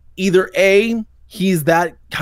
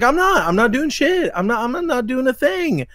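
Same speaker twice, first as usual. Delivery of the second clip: whiny denial; 7 semitones higher than usual. Retold or imitated. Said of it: "He's like".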